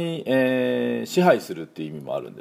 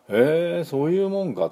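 retold surprise, pitched up; mildly surprised; 8 semitones higher than usual. Recalling hearing it from the French, mildly surprised. Retelling own surprised reaction.

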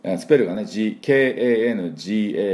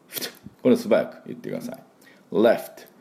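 normal talking voice

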